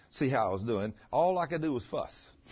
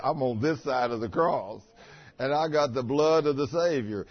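normal talking voice

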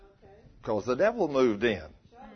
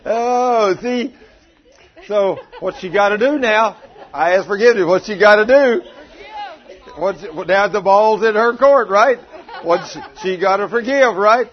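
high, very loud, fired up after laughing; amused loud insistence; 6 semitones higher, 10 dB louder than usual. Real, not acted. Preacher asking congregation what wife must do, amused loud insistence. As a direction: push it out loud over the laughter.

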